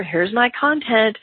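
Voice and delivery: ordinary talk, nothing marked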